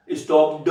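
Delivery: स्टॉप्ड with a voiced "duh" tacked on; wrong -ed sound. Shown as a demonstration. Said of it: "this is not डा".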